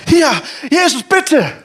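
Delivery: high, loud desperate call to Jesus; a leper begging Jesus from afar. Acted. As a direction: shout for attention from a distance.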